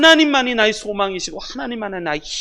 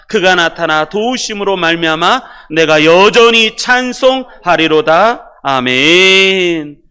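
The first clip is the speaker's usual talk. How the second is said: loud, slow, measured recitation; congregational scripture reading; 10 dB louder, slower than usual. Real, not acted. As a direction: read the verse out in full voice with drawn-out phrases. Leads congregation reading aloud together.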